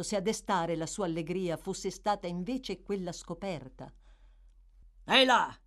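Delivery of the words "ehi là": loud, barking demand; sharp challenge. A narrator's reading. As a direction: lunge forward and snap it out. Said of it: "domandò Sikes, balzando avanti".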